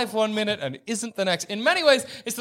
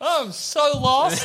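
high, slow, plaintive crab; slow mock helplessness; lost, bewildered crab; 6 semitones higher, much slower than usual. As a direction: draw it out high as a confused crab. Voicing bewildered crab in heaven.

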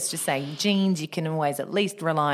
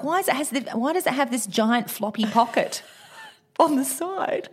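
bewildered, laughing; 8 semitones higher than usual. Retold or imitated. Retold confusion, laughing.

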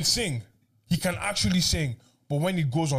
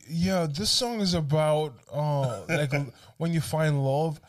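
slow, drawn-out put-on American accent; affected American twang; 2 semitones lower, much slower than usual. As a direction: draw out each word like a smooth pop star. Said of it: "goes like".

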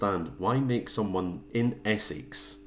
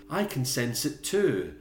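higher, slow mock séance voice; Helen; 4 semitones higher, slower than usual. Co-host mocking Helen at the séance, slow put-on voice. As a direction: draw it out, hammed-up acting. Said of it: "Helen was like".

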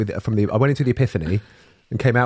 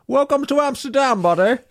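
higher, louder mock Dutch accent; 5 dB louder than usual. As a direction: play the Amsterdam dealer in a hammed-up Dutch accent. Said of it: "that was my Amsterdam accent, my Dutch accent".

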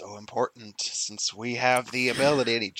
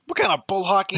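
loud, higher, scoffing creature voice; indignant outburst; 9 semitones higher, 8 dB louder than usual. Acted. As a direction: blurt it out in disgust. Thorax outraged at chronomancy claim.

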